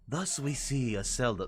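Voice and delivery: a hint of hushed documentary narration; failed Attenborough attempt. Player attempting an Attenborough line, half-hearted nature-narrator impression. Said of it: "no, I'm not even close".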